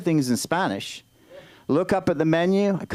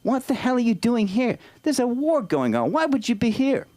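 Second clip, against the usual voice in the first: higher-pitched mock outrage; exasperated, raised-pitch questioning; indignant challenge; 5 semitones higher than usual. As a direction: challenge the ambassador in disbelief. Host confronts the fake ambassador.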